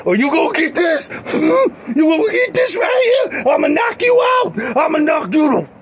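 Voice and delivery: very high, squealing threatening shout; hammed-up high-pitched rage; mock furious threat. Comic threat of a beating.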